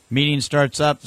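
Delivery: ordinary talk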